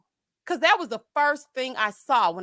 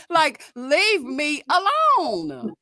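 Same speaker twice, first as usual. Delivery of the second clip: exasperated; 4 semitones higher, 5 dB louder, much slower than usual. Real, not acted. Exasperated at commenters.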